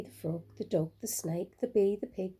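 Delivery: ordinary delivery, nothing marked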